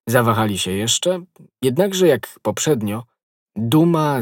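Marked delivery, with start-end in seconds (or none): none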